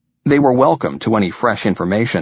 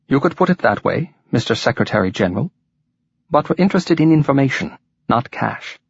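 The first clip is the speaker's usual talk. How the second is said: musical Welsh lilt, raised pitch; a lilting Welsh leader; Welsh accent; 4 semitones higher than usual. A narrator's reading. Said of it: "replied in a musical Welsh accent".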